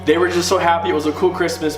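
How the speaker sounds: ordinary delivery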